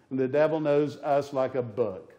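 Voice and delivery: ordinary delivery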